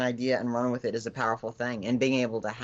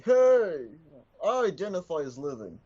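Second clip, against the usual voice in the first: zombie voice; 3 semitones higher, 6 dB louder than usual. Acted. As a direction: snap back as an offended zombie. Puppet Bill protests.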